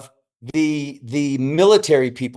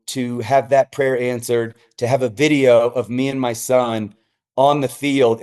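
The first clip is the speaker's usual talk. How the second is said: normal talking voice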